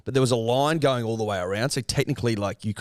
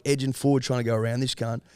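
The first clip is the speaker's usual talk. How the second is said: usual speaking voice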